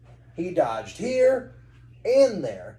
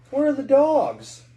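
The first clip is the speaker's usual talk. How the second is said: put-on high child voice; 10 semitones higher, 8 dB louder than usual. Boy asks about dogs.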